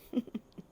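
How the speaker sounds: brief, quiet chuckle; real, soft giggle; soft laugh. Real, not acted. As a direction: let out a small laugh. Amused by own cooking chatter.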